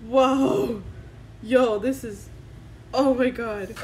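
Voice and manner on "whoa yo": ordinary delivery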